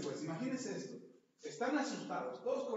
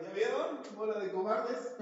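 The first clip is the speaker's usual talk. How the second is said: cocky taunt; 4 semitones higher, 4 dB louder than usual. Pretend. Peter boasting on the water.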